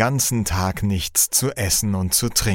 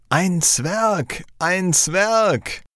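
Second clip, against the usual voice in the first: high-pitched mock crowd call, each cry falling; 9 semitones higher, much slower than usual. A narrator's reading. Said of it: "Alle rufen". (hoch gerufen, wie eine Menschenmenge)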